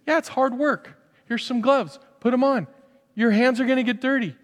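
high, encouraging invitation; inviting God. Pretend. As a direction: call them in to work together. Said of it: "He goes".

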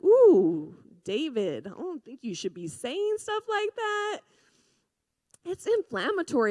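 loud "ooh", then high-pitched mock reproof; mock scandalized. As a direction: playfully scold as a shocked, prim reader. Acts out scandalized reader of Psalms.

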